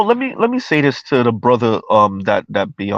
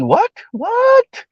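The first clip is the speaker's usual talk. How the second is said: incredulous what. Real, not acted. Reacting incredulously to a clip.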